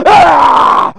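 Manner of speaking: bellowed grunt breaking into angry words; imitated weightlifter's scream. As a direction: roar it out like an angry lifter. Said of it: "he's going like this".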